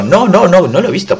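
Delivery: put-on high, flustered denial in a boy's voice. Boy denies to the girl, high and flustered.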